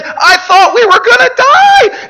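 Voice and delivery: very high, loud hysterical shriek; acted gasping, hooting hysteria; hooting with laughter. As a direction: shout it as if helpless with giggles. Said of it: "She laughed so hard she wept".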